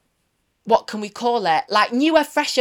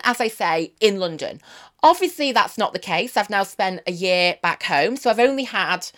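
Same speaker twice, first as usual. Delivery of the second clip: ordinary talk, nothing marked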